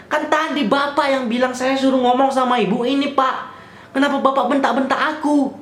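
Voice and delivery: high-pitched, retold complaint; indignant protest. Arguing back at the scolding officer, acted indignant protest. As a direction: plead your case, acted and aggrieved.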